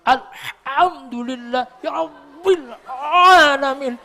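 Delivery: Alhamdulillah ya rabbil alamin exaggerated slowly with a laugh; mock heavy recitation. Shown as a demonstration. Teacher mocking an overdone recitation. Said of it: "Krana diabot-abot dhewe".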